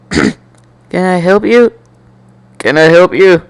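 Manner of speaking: usual speaking voice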